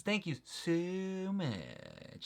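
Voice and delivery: high, drawn-out thanks dropping at the end; signature thank-you. Shown as a demonstration. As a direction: re-enact an exaggerated thank-you. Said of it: "That's how I do my thank you".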